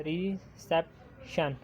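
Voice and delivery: Reception said slowly, syllable by syllable; slow syllable count. Shown as a demonstration. Teacher counting syllables aloud, slow and deliberate. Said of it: "three हैं".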